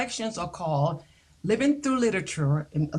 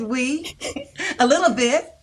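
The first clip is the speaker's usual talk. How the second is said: shy laughing reply; 5 semitones higher than usual. Retold or imitated. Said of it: "And I said".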